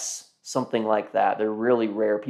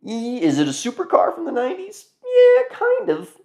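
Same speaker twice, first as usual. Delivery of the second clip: high playful voice; 14 semitones higher, 3 dB louder than usual. Real, not acted. Self question and answer, high.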